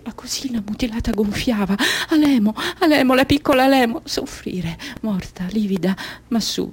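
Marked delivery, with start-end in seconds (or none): 2.1-4.0 high, urgent cry; distressed calling. Acted. Girl in pain calling little Alemo, distressed. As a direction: call the name out in rising anguish.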